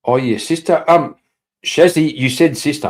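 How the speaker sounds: usual speaking voice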